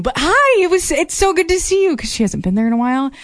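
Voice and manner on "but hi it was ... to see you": high-pitched imitation of a gushing hello; effusive greeting. Studio owner greets a returning dancer, high gushing imitation.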